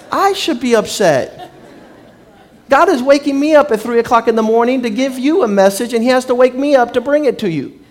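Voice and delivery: mock outrage, loud and high. Real, not acted. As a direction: let it rip at the man who complained. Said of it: "I have to talk like this".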